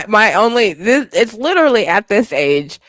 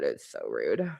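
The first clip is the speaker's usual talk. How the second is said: almost inaudible; 17 dB quieter than usual. Real, not acted. Fading repetition of rude.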